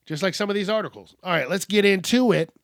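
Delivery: usual speaking voice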